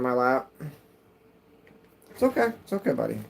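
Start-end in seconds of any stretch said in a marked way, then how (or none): 2.1-3.2 calm the frightened cat down; cooing to a scared pet. Real, not acted. Soothing startled cat.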